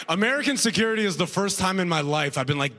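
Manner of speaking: ordinary talk, nothing marked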